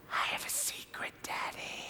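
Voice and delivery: soft unvoiced child whisper. Son whispering a secret to his dad in the car. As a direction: breathe it into his ear like a secret. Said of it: "he whispers in my ear".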